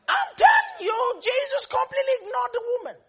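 high preaching shout. Real, not acted. Preacher telling the church Jesus ignored her, shouted high. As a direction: burst out high and loud.

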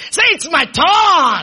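slow shouted call to the congregation; serious rallying shout. Shown as a demonstration. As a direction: shout it out, stretching TURN. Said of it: "some of you are not serious".

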